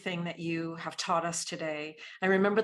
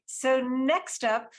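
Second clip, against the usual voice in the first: slow, drawn-out emcee announcement; big guest introduction; 4 semitones higher, 4 dB louder, slower than usual. Real, not acted. Host bringing on the next speaker, slow showy introduction. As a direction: build it up and stretch out the name.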